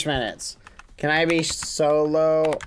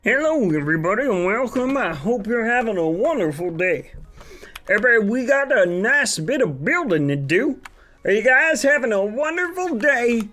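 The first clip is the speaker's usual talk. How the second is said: cheesy wholesome host; 6 semitones higher than usual. Shown as a demonstration. Streamer auditioning a clean character voice for chat. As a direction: ham up an overly friendly kids' show host. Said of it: "let's solve my character chat".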